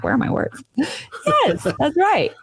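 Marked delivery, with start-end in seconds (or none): 0.0-0.6 low whisper to herself; whispered aside. Retold or imitated. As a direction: mutter it under your breath. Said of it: "I'm like".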